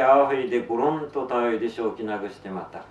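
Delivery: plain reading voice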